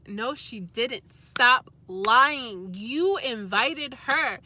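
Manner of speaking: slow, loud stop lying; firm angry. Retold or imitated. Arguing with her lying boyfriend, slow and loud. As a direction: lay down the law slowly.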